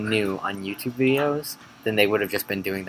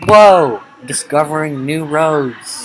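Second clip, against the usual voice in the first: mock amazement; 5 semitones higher, 10 dB louder, much slower than usual.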